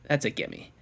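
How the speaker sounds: quiet self-assurance; muttered aside. Real, not acted. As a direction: mutter it to yourself. Muttered repeat.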